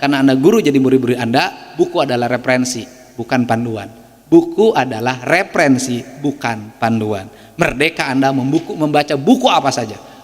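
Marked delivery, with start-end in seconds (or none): 4.2-7.3 slow and deliberate, letting each word land; slow emphatic repeat. Shown as a demonstration. Speaker repeats his maxim to teachers, slow and deliberate. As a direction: spell out the maxim slowly so it sinks in.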